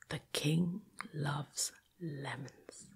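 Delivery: plain reading voice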